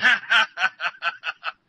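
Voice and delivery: real laugh at a joke; sustained genuine laughter; hearty laugh. Real, not acted. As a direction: burst out laughing at the punchline. Laughs at the joke's punchline.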